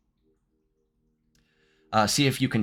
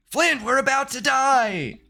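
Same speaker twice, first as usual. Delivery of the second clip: high, drawn-out panicked wail; mock panic; 14 semitones higher than usual. Trucker warning his brother of death, hammed-up high panic. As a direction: cry out in hammed-up terror. Acted ghost hunter facing death.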